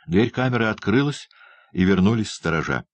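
usual speaking voice

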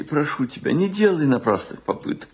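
normal talking voice

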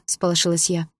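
ordinary delivery, nothing marked

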